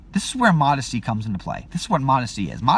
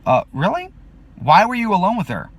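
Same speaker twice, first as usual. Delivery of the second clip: pointed, louder and higher challenge; accusing question; 7 semitones higher, 4 dB louder than usual. Retold or imitated. An onlooker accusing the teacher, pointed and raised. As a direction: demand an answer, accusingly. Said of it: "That's what a person would say to me".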